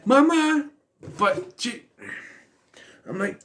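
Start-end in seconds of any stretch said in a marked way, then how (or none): 0.0-0.7 high, loud mock child's cry; imitating a kid's loud, high call; child calling for mother. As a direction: call out "mama" like a needy kid. Kid calling for mother again.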